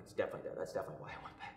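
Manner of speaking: whispered. Retold or imitated. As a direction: admit it under your breath. Guilty reply to disappointed doctor.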